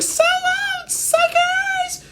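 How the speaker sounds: very high squeaky taunt; very high helium-voiced farewell taunt. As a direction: sound like you've sucked helium. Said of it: "voice is getting higher 'cause of the helium".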